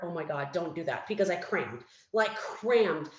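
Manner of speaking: usual speaking voice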